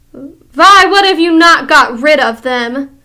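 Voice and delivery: high shrieking scream. Acted. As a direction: scream the question at them. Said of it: "she screamed".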